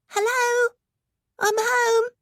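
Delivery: put-on high bright child voice; little boy. A narrator's reading. Ricky arriving home, calling out high. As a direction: call out like an excited small boy.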